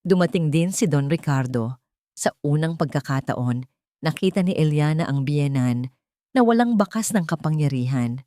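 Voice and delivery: plain reading voice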